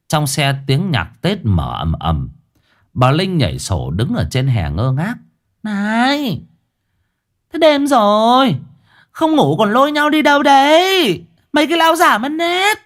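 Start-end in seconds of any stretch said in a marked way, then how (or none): 5.6-12.8 put-on much higher old woman's voice, scolding her husband; shrill old wife scolding. Wife scolds husband driving off at night, high nagging voice. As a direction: nag him like a cross old wife.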